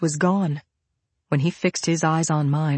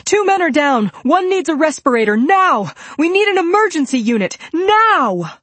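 high, loud urgent yell for help; full-voiced, high-pitched urgent shouting; 12 semitones higher, 6 dB louder than usual. A narrator's reading. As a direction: yell orders in an emergency. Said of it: "I flashed my badge and I yelled".

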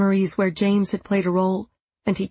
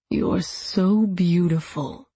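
put-on drunken leer; slower than usual. A narrator's reading. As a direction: drag it out like a drunk creep. Drunk attacker leering.

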